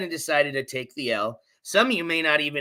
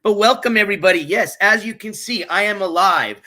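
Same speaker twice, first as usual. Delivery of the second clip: big loud welcome; loud greeting; 6 semitones higher, 8 dB louder than usual. Real, not acted. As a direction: boom out the welcome. Stream greeting to viewers.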